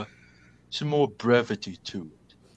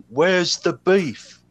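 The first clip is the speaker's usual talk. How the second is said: "Where's the beef?" slow and louder, "Where's" hit high; slow, louder punchline; 4 semitones higher, 5 dB louder, much slower than usual. Shown as a demonstration. Suggesting a line to the player, slow and loud. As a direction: punch it like a catchphrase. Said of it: "Maybe something along that line".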